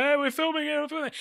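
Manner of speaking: high-pitched mock crew call-out; imitated film crew. Crew warning off a bystander who walks on set, imitated high-pitched call. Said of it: "and they're like".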